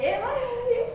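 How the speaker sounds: high-pitched, urgent question; exasperated high cry; insistent demand. Real, not acted. Alarmed questioning of laughing sister.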